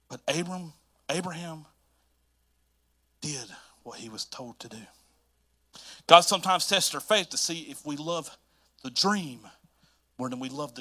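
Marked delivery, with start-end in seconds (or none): 3.2-4.8 hushed whisper for weight; a breathy dramatic whisper. Real, not acted. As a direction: drop to a whisper to make them lean in. Sermon beat, dramatic hush.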